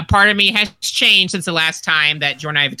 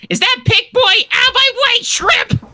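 put-on high squeaky kid voice; shrill fan shoving past; squeaky excited fan; 3 dB louder than usual. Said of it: "you'd go".

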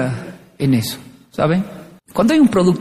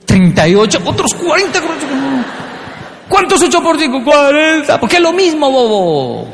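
angry yelling adult; 9 semitones higher than usual. Retold or imitated. Furious adult yells at the child over the wrong answer. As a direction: yell the punishment in a rage.

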